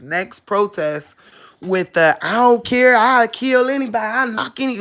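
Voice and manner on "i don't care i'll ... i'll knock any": raised, higher voice mimicking reckless bravado; mock someone ready to fight anybody; savage mindset. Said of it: "with that savage mind frame".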